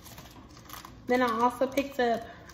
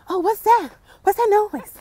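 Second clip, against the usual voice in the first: higher, louder nervous horror-movie voice; 9 semitones higher, 7 dB louder than usual. Retold or imitated. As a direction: play the curious victim in a scary film.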